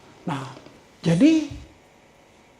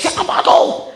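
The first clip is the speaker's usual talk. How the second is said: high laughing mock yell; a hammed-up shout of who's there; 10 semitones higher, slower than usual. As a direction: call out with a laugh. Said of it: "gua berteriak".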